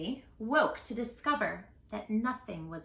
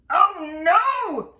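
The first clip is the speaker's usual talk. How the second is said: loud, higher wail; mock dismay; 7 semitones higher, 11 dB louder than usual. Norman seeing Jim trip, loud mock dismay. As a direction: cry out in sympathy.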